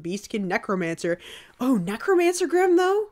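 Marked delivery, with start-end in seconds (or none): 1.6-3.1 high, delighted rising question; thrilled discovery; excited. Real, not acted. Excited by new class.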